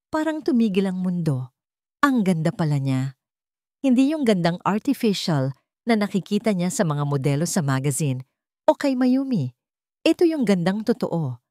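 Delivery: usual speaking voice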